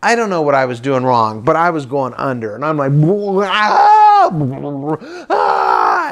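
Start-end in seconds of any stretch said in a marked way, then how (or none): 3.5-6.1 acted-out drowning yell; screaming. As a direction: scream as you are dragged under. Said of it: "and I'm just screaming".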